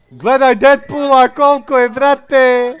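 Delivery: loud excited high shouting; screaming fan. Real, not acted. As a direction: yell it out like a thrilled fan. Streamer hyped over game skin.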